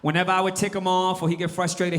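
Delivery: normal talking voice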